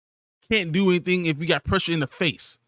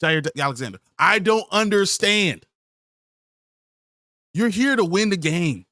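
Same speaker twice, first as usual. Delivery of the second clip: normal talking voice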